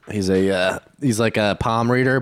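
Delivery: ordinary talk, nothing marked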